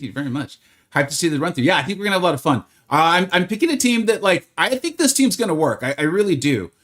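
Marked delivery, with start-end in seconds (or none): none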